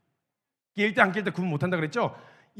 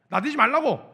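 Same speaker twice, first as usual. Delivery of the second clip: 말라고 snapped out loud and higher; scolding shout; 4 semitones higher, 6 dB louder than usual. Retold or imitated. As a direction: bark 나대지 말라고 like a rebuke. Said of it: "말리기 시작한 거예요".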